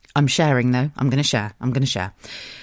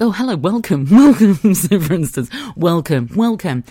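mock welcome; 4 semitones higher, 8 dB louder than usual. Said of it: "it's like".

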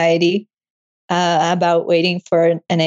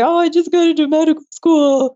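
higher, teary voice through happy tears; crying with joy; 8 semitones higher than usual. Retold or imitated. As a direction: blurt it out while still crying. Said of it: "And I was just crying".

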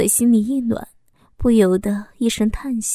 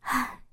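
weary sigh; 2 semitones higher, 10 dB quieter than usual. Acted. Narrator sighs, worrying about him.

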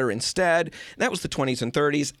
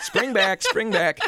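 laughing; 8 semitones higher, slower than usual. Real, not acted. Laughing repeat of spring back.

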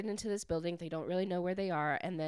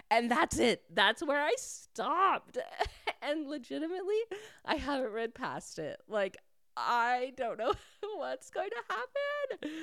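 giddy distress; 8 semitones higher than usual. Real, not acted. Reader who stopped at the cliffhanger, frantic and giddy. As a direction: squeal it, half laughing, as an overwrought fan.

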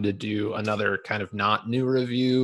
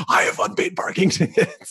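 movie quote; 9 semitones higher than usual. Retold or imitated. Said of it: "he pops up in the glove box".

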